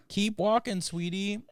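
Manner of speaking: high, cooing reassurance; high-pitched pep talk to a sweetie; sweet encouragement. Acted. Reassuring someone, acted encouragement.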